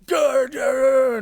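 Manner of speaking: loud, high-pitched angry growling noise; shouted mock snarling; mean old growl. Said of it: "that mean old".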